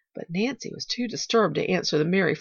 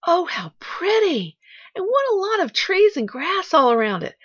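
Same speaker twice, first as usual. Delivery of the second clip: put-on high, loud childlike wonder; delighted exclamation; 12 semitones higher, 7 dB louder than usual. A narrator's reading. Pollyanna first seeing her aunt's house, delighted. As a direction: marvel with rising delight.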